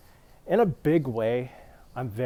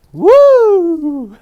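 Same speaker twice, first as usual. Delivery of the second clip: very high, very loud whooping woo; imitating a cheer; 20 dB louder than usual. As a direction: whoop like a rowdy crowd. Three American guys cheer.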